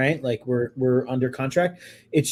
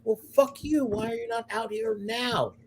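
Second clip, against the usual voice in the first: mock angry rant; 7 semitones higher than usual. Retold rant at contractor.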